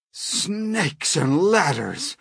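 high, stretched-out exclamation; horrified yell. A narrator's reading. Fire chief seeing Miss Spider, horrified yell. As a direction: draw the oath out in horror. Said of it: "yelled the head of the fire department".

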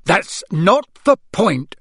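exasperated father's shout; raging. A narrator's reading. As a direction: thunder each word at the boys. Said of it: "raged Mister Weasley".

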